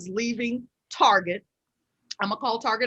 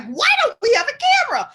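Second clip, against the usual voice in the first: high, quick imitation of a whining kid; high-pitched mock complaint; daughter's complaint; 14 semitones higher, 4 dB louder, faster than usual. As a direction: mimic your kid pestering you, pitched way up. Voices her daughter's complaint.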